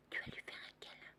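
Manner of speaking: soft unvoiced whisper. Real, not acted. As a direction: whisper the question.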